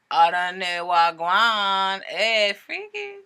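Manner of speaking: slow drawn-out playful call; drawn-out cheeky exclamation, rising at the end; playful teasing. Pretend. Joking aside about card creature.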